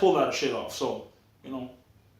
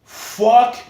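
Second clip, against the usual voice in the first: retold outburst; 8 semitones higher, 8 dB louder than usual. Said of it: "I'm like".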